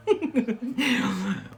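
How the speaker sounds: high chuckle after teasing; real laugh. Real, not acted. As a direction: let out a high run of giggles. Laughs at own teasing threat.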